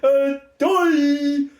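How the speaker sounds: gleeful sneering taunt, the stupid fuck line slowed; mocking taunt. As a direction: laugh at them like a cruel twist ending. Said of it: "and then just goes".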